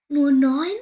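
loud, high alarmed question; startled. A narrator's reading. Hare mother finding a baby missing, startled cry. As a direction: blurt it out in shock. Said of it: "fragt die Hasenmutter erschrocken".

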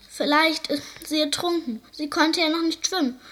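the seal sobbing out his fear she drowned; wailing seal. A narrator's reading. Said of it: "heulte der Seehund".

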